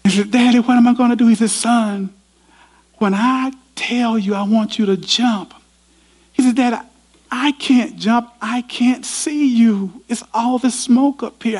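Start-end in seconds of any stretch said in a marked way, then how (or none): 0.3-1.3 put-on scared boy. Boy tells his father the stairs fell, high and scared. 1.5-5.5 urgent father. Retold or imitated. Father instructs son. 6.6-11.6 put-on scared boy. Boy tells his father the stairs fell, high and scared.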